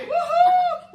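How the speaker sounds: excited whoop. Real, not acted. Celebrating winner.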